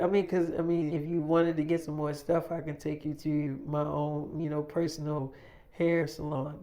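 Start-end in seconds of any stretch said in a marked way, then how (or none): none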